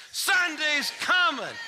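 high, slow call-back; shouted answer. Shown as a demonstration. Audience asked to shout back, slow. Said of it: "give me one more chance".

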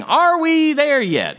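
put-on high, slow whining child; whiny kid. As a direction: drag it out like a bored kid.

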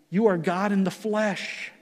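ordinary talk

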